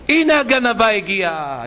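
high, slow jeering announcement; mock yell. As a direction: announce the thief's arrival to the whole shop. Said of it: "נתנה צעקות".

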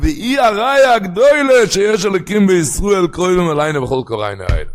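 loud, high declaiming of scripture; full-force preaching. Acted. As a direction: proclaim the sacred text at full voice.

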